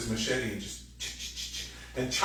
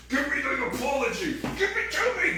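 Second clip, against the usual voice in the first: much higher, louder, laughing demand; mock demanding whine; 4 dB louder than usual. Wrestling someone for an apology, mock high whiny demand.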